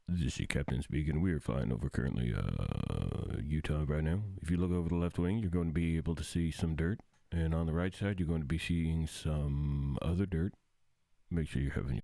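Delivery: low airline captain announcement; pilot voice. Acted. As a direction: announce it like a pilot over the intercom. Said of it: "the pilot voice".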